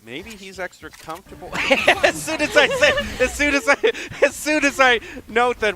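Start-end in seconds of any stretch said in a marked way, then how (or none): 2.0-5.8 high, loud and laughing; amused outburst. Real, not acted. Caster cracking up at co-caster over the timing, laughing outburst. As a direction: burst out high and loud, tickled.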